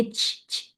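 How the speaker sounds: "چ" as a bare airy puff; short voiceless "چ" puffs; wrong "ch" sound. Shown as a demonstration. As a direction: show the stray "چ" release. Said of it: "یہ والی sound نہیں ہوگی".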